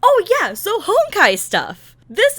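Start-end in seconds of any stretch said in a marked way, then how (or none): none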